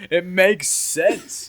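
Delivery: slow, laughing reply; laughing through the words. Real, not acted. Laughing during a playful argument.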